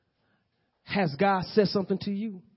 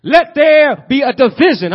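God commanding light into being; booming voice of God; 8 semitones higher, 12 dB louder than usual. Acted. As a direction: proclaim it like God creating. Preacher voicing God speaking creation.

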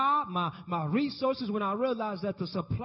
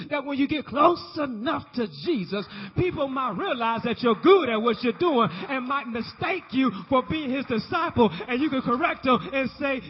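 loud, climbing preacher's call; rousing sermon peak; full-force preaching; 5 semitones higher, 7 dB louder than usual. Acted. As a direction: preach it out to the congregation.

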